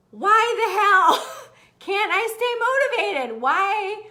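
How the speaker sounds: loud, high comic outcry; mock frustration. Host voicing the fed-up struggler, loud mock despair. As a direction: wail it with a laugh breaking in. Acts out frustration at lost motivation.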